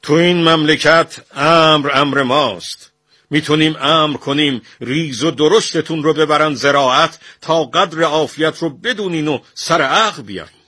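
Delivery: loud, high-pitched royal fury; roaring anger. A narrator's reading. Shah warns the artists who rules this country, roaring. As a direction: roar out a threat as the one in command. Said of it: "شاه غرید که".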